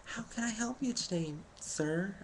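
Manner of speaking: put-on high, polite old lady voice at the post office counter; sweet old lady. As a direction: greet him like a kind elderly clerk. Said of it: "she's just like".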